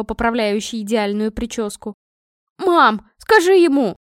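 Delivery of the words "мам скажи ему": high, loud call to mum; shrieked-up demand for help; desperate appeal. A narrator's reading. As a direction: cry out for backing. Daughter appeals desperately to mother.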